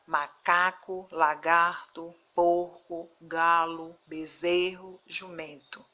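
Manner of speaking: maCAco, laGARto, beZERro, strong next-to-last syllable, final O audible; stress before last syllable. Shown as a demonstration. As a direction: sound out Macaco, lagarto, porco slowly, punching the strong syllable. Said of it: "Pronunciem e vejam qual a sílaba mais forte". (Macaco, lagarto, jumento pausadamente, com a penúltima sílaba forte)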